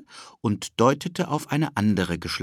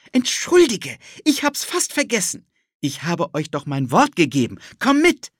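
put-on high, eager little-boy voice; a pushy kid tugging at his friend; cheeky boy Ted; 10 semitones higher, 6 dB louder than usual. Ted pulls Philipp along, eager high boy voice.

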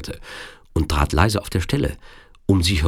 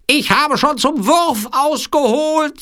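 very high, slow villain voice; threatening Sicilian villain; 4 dB louder, slower than usual. A narrator's reading. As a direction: threaten slowly in a piercingly high voice. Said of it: "sagte der Sizilianer". (langsam drohender Sizilianer, sehr hoch)

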